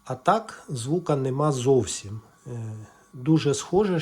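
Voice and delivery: ordinary talk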